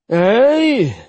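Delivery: sharp bitter aye; angry. Acted. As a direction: bite it off angrily. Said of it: "His mouth twisted angrily".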